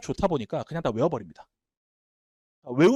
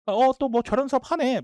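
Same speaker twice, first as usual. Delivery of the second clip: higher, drawn-out mock unimpressed student; 팔짱 낀 시큰둥한 학생; 8 semitones higher, 4 dB louder, slower than usual. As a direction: lightly imitate a jaded student sizing up yet another class. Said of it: "팔짱 끼고 수업을 듣는데". (팔짱 끼고 시큰둥한 학생 흉내)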